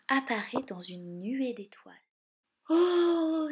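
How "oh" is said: high, loud oh of wonder; amazed exclamation. A narrator's reading. Jacques spotting the good fairy, amazed exclamation. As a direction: gasp out in amazement. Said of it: "s'exclame Jacques".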